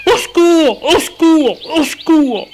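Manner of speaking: very high, loud shouted cries; repeated desperate shouts for help; crying for help. A narrator's reading. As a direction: yell for help as loud as you can. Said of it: "Frédéric se met à crier".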